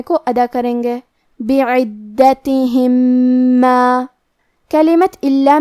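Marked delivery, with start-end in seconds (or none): 1.4-4.0 slow recitation of بعدتہم ما with the two mims merged; tajweed demo. Shown as a demonstration. Teacher reciting merged mim for students. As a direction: draw out بعدتہم ما, nasalized doubled mim. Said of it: "ہم پہلے میم کو دوسرے میم میں ملا کے".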